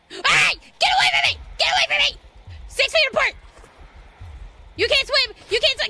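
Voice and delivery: high, loud panicked shouts; frantic yelling. Real, not acted. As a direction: yell at the creature to back off. Monster chasing, yelling at it.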